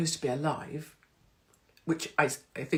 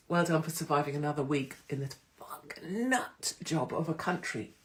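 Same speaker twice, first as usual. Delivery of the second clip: voiceless, softer aside; whisper; 4 dB quieter than usual. Real, not acted. Signing off to viewers in a whisper.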